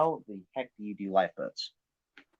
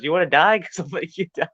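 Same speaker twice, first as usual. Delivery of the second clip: quoting Levy; 6 semitones higher, 7 dB louder than usual. Retold or imitated. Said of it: "This is the first thing Levy says".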